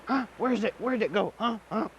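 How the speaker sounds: high, insistent questioning; jumping up, desperate to find it; eager demanding. Acted. Sagani getting up, demanding where the house is, high and eager. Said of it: "acting, getting up and wondering".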